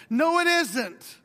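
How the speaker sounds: preacher refusing the excuse; emphatic denial. Acted. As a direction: stamp out the objection word by word. Preacher rebuts imagined objection.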